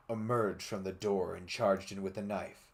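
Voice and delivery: plain reading voice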